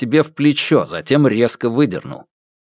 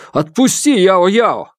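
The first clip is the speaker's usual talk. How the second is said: desperate demand; 9 semitones higher, 4 dB louder, slower than usual. Acted. Father demands daughter's release.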